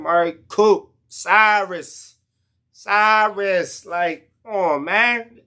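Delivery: drawn-out exasperated come on. Real, not acted. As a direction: drag out the names in disbelief. Exasperated listing players.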